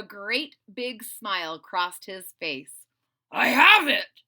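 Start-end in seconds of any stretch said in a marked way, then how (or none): 3.3-4.2 loud triumphant shout. A narrator's reading. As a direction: burst out with the idea. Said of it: "he shouted".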